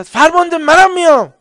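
loud, high soldier shouting; eager loud shout; shouted call. Retold or imitated. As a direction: yell across the line to volunteer. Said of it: "یکی داد زد".

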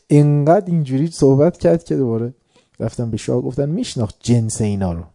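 ordinary talk, nothing marked

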